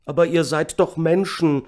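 high, loud desperate appeal; shouted plea. A narrator's reading. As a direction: cry out to the soldiers. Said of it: "schrie einer". (flehend geschrien)

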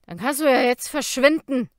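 put-on loud dismissive drunk; barfly. A narrator's reading. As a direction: shove her off with a loud snarl. Drunk Jacob dismisses Kara.

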